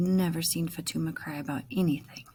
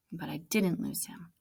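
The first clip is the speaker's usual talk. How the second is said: ordinary delivery, nothing marked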